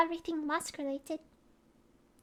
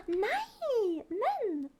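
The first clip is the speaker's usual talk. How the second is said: higher delighted nei men; happy surprised nei men; 4 semitones higher than usual. Shown as a demonstration. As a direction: greet a friend with glad surprise. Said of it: "they say".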